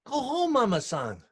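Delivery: gentle urging. Retold or imitated. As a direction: send her home kindly. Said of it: "And he say".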